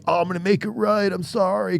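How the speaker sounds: high, whiny mock apology; hammed-up sorry contractor; fake apology. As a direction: mimic a weaselly excuse.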